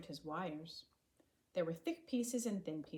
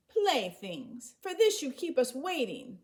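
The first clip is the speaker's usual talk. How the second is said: loud, higher, annoyed complaint; angry grumbling; 7 semitones higher, 8 dB louder than usual. A narrator's reading. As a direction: scold him for wasting their time. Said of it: "the women grumbled angrily".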